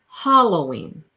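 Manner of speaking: Halloween said "HALL-oh-ween"; DJ's "hall" pronunciation. Retold or imitated. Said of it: "hall, like you go down the hall".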